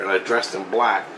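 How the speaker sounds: ordinary delivery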